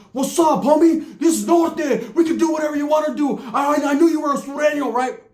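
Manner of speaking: cousin's taunt. Retold or imitated. Cousin taunting the paisa before fighting. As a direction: puff up and taunt him. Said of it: "cousin taunting in fight".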